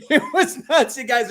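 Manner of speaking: high, drawn-out words through laughter; real amused laugh in the voice. Real, not acted. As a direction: tell it while cracking up. Laughing over the mohawk story.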